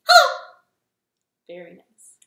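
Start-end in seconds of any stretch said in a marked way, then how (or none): 0.1-0.4 short, sharp, high and loud hello; quick loud hello. Shown as a demonstration. Host snapping a fast loud hello again. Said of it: "Can you do it again?".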